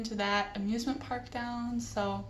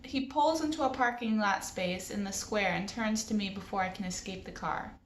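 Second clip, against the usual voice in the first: ordinary delivery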